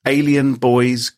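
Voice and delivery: ordinary delivery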